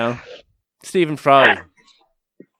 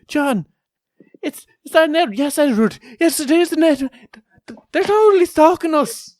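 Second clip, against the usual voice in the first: much higher, hammed-up fake Irish accent; bad Irish accent; 4 dB louder than usual. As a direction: play a bad American doing an Irish accent. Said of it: "bad Americans trying to be Irish accents".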